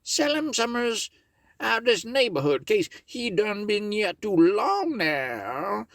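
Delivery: pitched well up for a critter in the tale; high character voice. Acted. Brer Tarrypin telling Miss Meadows the news, high character voice.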